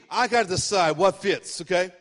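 ordinary talk

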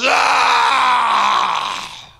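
long loud yell into the storm; defiant scream. Retold or imitated. Castaway standing up to the storm, screaming in defiance. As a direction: let out a drawn-out defiant roar. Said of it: "stands up to the storm and goes".

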